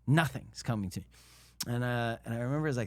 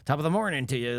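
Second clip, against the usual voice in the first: loud stage-Irish greeting; Irish lilt; 2 semitones higher, 6 dB louder, faster than usual. Shown as a demonstration. Actor showing the too-full Irish accent, exaggerated lilt. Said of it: "like, lilt".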